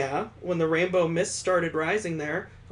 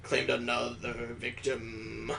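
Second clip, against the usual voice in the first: ominous narrator; 7 semitones lower, much slower than usual. Acted. Ominous narration of game intro.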